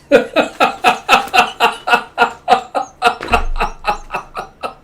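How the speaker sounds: airy wheezing laugh; breathless laugh. Real, not acted. Laughing at their own pampered-warrior voice. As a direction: collapse into silent laughter.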